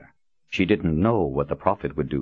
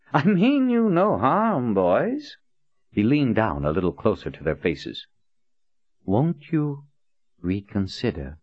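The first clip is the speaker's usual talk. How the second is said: slow, high and silky; a hint of veiled threat; sinister politeness; 6 semitones higher than usual. Prophet menacing politely.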